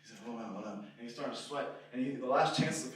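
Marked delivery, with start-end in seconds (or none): none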